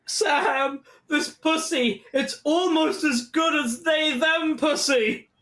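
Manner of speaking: high, drawn-out mock anguish; hammed-up desperate pleading. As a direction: plead as if nobody gets it. Acts out Daniel pleading desperately.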